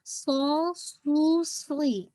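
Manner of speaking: सौ, सू, सोई each drawn out with a heavy s; heavy saad. Shown as a demonstration. Teacher drills the letter saad, deliberate recitation. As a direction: sound out सौ, सू, सोई one by one. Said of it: "स्वादम सू".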